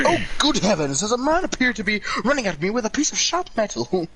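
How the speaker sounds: high-pitched, frightfully posh British alarm; posh British fright. Acted. Very British victim chased by a murderer, posh alarm. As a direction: play scared in an exaggerated posh British accent. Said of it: "posh way of being scared".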